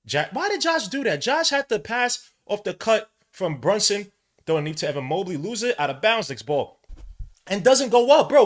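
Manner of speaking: high, loud exasperated complaining. Real, not acted. Frustration at the player.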